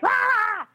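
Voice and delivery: very high, sharp "ah!" of frustration; short high frustrated shriek; frustrated yell. Retold or imitated. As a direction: vent it in one high yell. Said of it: "we were just frustrated".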